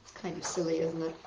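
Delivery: plain reading voice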